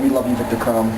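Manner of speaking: ordinary talk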